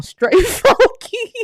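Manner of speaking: high, slow laughing delivery. Retold or imitated. Reading a viewer's plane-ride story, laughing at the smell. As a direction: laugh it out, disgusted.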